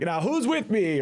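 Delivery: high, rousing mock rally call; imitating a stump speech rallying cry. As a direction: pump up the crowd, half in jest. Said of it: "and then you're like".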